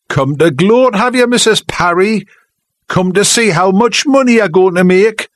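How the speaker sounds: high, loud jeering taunt. Acted. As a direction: jeer at her so the whole hall hears. Taunting her before the hall.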